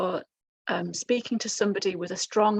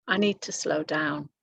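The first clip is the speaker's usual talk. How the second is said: mild imagined listener thought; 4 semitones lower, 5 dB quieter than usual. Retold or imitated. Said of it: "You're going to think".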